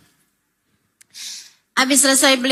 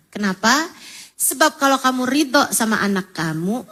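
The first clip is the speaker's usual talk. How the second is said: ordinary talk, nothing marked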